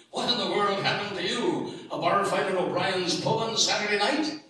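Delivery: loud, high, shocked; loud exclaiming. Acted. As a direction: shout it out in shock.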